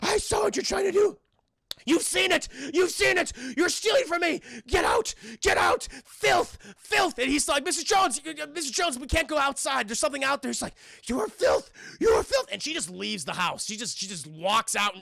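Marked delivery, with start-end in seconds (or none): 0.0-7.2 put-on shrieking old-lady fury, high and loud; hammed-up screech, hurling each accusation; hysterical accusing rant. Missus Jones throws him out, high shrieking rage. 7.6-10.5 high, urgent mock plea; panicked pleading. Man warns Missus Jones about outside, pleading urgently. 11.1-12.5 high, loud screech of disgust; hammed-up crazy-lady shriek; mock furious condemnation. Missus Jones condemns him, high screeching disgust.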